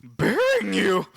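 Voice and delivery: slow, drawn-out mock menace; mock Godzilla voice. S0 voicing Godzilla burying Kong, slow smug voice. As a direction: say it slowly and smugly. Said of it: "he's like".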